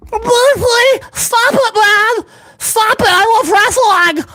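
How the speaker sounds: high-pitched mock fanboy protest; mocking, whiny high voice; whiny wrestling fan. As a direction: plead like an offended fan.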